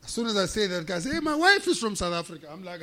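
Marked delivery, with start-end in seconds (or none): 1.1-2.5 high, loud delighted exclamation; excited imitation. Manager to South African customer, excited. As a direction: burst out with happy recognition.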